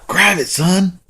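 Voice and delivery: excited dad's retold order; urgent urging. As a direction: urge him to grab it right now. Father urges son to grab pole.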